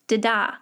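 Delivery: "da-da" as one word, "di-DAH", stress last; quick light first "da", strong second "da". Shown as a demonstration. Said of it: "Always put your emphasis on das".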